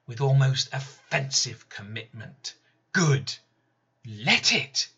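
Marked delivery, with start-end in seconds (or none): none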